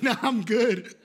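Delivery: loud, laughing brush-off; laughing refusal. Retold or imitated. Pastor declines weed from woman he prayed for, laughing. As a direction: turn it down while cracking up.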